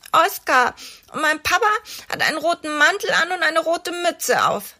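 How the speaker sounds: viel höhere Kinderstimme; kleiner Junge. A narrator's reading. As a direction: sprich wie ein kleiner Junge mit hoher Stimme. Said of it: "sagt der Junge". (Oskar erzählt den Kindern von seinem Papa, hohe Kinderstimme)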